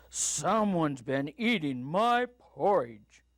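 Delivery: slow, big papa bear voice; booming Papa Bear. A narrator's reading. Papa Bear finds his porridge eaten, slow booming voice. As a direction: boom it slowly, leaning on MY. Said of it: "said Papa Bear in his booming voice".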